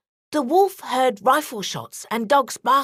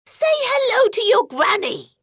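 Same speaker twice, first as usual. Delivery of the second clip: higher, irritated granny voice; wolf getting angrier, higher; annoyed fake granny; 12 semitones higher, 4 dB louder than usual. A narrator's reading. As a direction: bristle like a grumpy granny. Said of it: "getting angrier".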